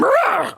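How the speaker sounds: sudden high roar to startle; monster roar. Pretend. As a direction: jump out with a roar. Monster jumps out in dark.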